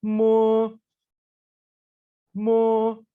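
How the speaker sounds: teacher isolating the initial मो; Chinese sound mo. Shown as a demonstration. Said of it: "initial है".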